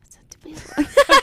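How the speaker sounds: pushed stage whisper into the mic; giggly prank whisper. Real, not acted. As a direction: whisper loudly, teasing. Whisper correcting partner.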